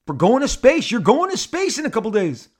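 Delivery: high, loud, incredulous enthusiasm; excited. Acted. I explain to Shatner about going to space, excited. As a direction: act out gushing at a star, voice way up. Teller acts own excited reply.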